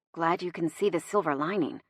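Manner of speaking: soft, dry aside; muttered. A narrator's reading. Driver answers Chrissy's rant, muttering. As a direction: drop it under your breath. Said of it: "I mutter".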